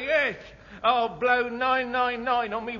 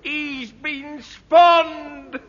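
excited; 6 semitones higher, 6 dB louder, much slower than usual. Acted. Constable discovers body.

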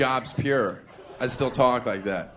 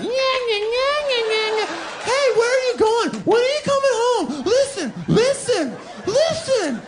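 very high, louder nagging whine; whiny high voice; 4 dB louder than usual. Acted. Clingy partner nagging as he leaves, very high whine. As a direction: squeak it out like a clingy nag.

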